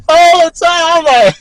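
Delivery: high, loud cracking-up laughter; howling laughter. Real, not acted. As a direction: laugh so hard the voice squeaks.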